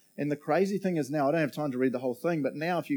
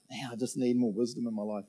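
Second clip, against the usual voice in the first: ordinary talk, nothing marked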